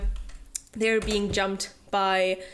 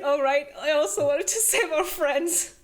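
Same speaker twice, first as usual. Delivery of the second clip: lightly voiced, sheepish boyfriend; mock Rune; 5 semitones higher than usual. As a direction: tease him with a hint of a put-on voice. Teasing retold Rune line.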